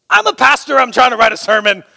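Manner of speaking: mock shout to a hard-of-hearing man; yelling back. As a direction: shout it across the table. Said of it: "yelled back at him".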